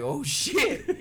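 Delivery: high-pitched startled mom; alarmed mom. Retold or imitated. Mom realizes her son hates clowns, mock alarm. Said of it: "mom immediately was like".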